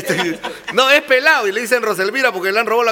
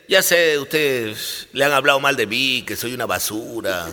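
much deeper, softer devil; 10 semitones lower, 5 dB quieter than usual. Acted. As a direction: growl it low like the devil. Devil speaks to the dead. (voz del diablo muy grave)

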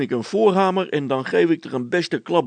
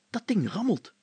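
ordinary delivery

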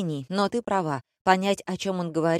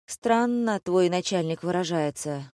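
plain reading voice

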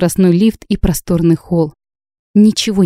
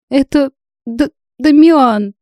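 stammering through tears; 8 semitones higher, slower than usual. A narrator's reading. A crying girl names the man who upset her, stammering. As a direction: stumble on the name while crying. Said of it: "заикаясь".

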